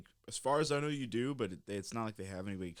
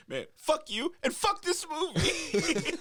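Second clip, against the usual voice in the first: slow, loud, high-pitched yell through laughter; laughing, hammed-up rage; mock furious outburst; 8 dB louder, slower than usual. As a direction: act out storming off, stretching every word. Acting out storming out of movie.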